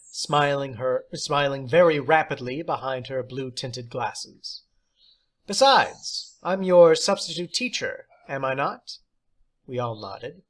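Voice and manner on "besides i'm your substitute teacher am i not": high, louder teacher's voice; theatrical teacher. Acted. Substitute teacher praising John, high and theatrical. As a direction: play the odd substitute, grand and pleased.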